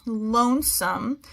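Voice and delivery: lonesome repeated slowly and clearly, ending on a rise; slow careful word. Shown as a demonstration. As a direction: say lonesome again, slow and clear for young readers. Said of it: "So we have this word".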